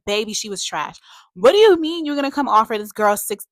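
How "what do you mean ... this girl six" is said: loud, high-pitched disbelief; indignant scolding. Acted. Reviewer scolding a character over a bribe, loud indignation. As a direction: confront them, outraged and loud.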